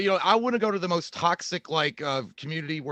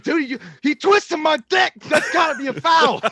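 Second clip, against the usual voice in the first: mock outrage; 9 semitones higher, 6 dB louder than usual. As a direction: cry foul like a fighter in shock. Acts out the outraged fighter.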